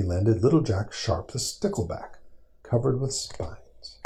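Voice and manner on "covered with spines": soft whispered aside; whispering. A narrator's reading. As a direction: hush it like a secret. Whispered spooky detail.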